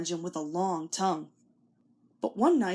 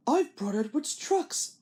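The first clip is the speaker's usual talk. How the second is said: Thomas voice; 4 semitones higher than usual. A narrator's reading. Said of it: "Thomas answered".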